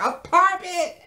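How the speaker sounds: very high, loud mock disbelief; incredulous squawk. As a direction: squawk it as a stunned question. Own incredulous reaction, replayed.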